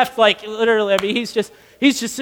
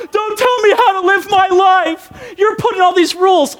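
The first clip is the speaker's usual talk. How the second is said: high, hammed-up angry outburst; indignant shout; 7 semitones higher than usual. Castaway objecting to rescuer's rules, acted indignant shout. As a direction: explode in a high-pitched mock protest.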